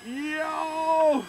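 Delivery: high, laughing cries of yo; excited yelps. Real, not acted. Pilot cheering his plane's takeoff, excited high yelps.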